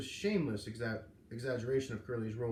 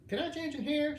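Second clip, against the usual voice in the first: much higher rasping granny voice; husky voice; 14 semitones higher, 4 dB louder than usual. A narrator's reading. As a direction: rasp the question out like a crusty old lady. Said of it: "she asked in a husky voice".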